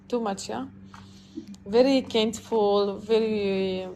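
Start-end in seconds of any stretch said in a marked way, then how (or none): none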